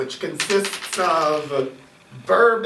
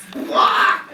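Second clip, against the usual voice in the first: parrot squawk; 5 dB louder than usual. Acted. GM playing parrot.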